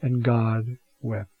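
quiet, drawn-out scripture echo; slow solemn echo. Acted. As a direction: linger on every word, grave. Preacher echoes line on God weeping.

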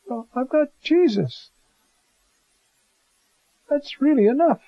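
carefree, giddy delight; joyful. Acted. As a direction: Zacchaeus rejoicing that Jesus is enough. Zacchaeus's joyful freedom.

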